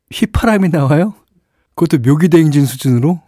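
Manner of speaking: high-pitched incredulous question; amused disbelief. Real, not acted. DJ reacting to whistling mid-song, amused disbelief.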